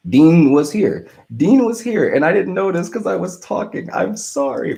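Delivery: high-pitched, loud disbelief; astonished, pitch leaping way up; dismayed realisation. Real, not acted. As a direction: exclaim it as it sinks in, then apologise. Realises he missed Dean, apologises.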